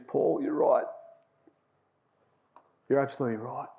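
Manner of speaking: subdued, halting confession with long pauses; retold near-tears. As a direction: softly imitate a workmate close to crying. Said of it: "his voice is quivering like he's nearly crying".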